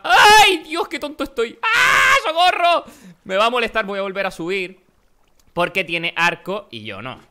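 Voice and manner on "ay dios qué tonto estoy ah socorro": high yelling cry for help while laughing; panicked shout. Real, not acted. Gamer attacked by a rival, panicked laughing yell. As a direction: scream as he gets hit.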